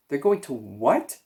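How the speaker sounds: slow, stunned and strained disbelief; a mild narrated shriek of horror; shocked scream. A narrator's reading. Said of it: "screamed Wilbur".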